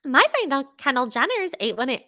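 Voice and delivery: high, put-on mocking voice; Lisa Rinna, mimicked mockingly. Host copying Kathy mocking a castmate's tequila order, high mimicking voice. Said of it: "like, mimic Lisa Rinna's voice".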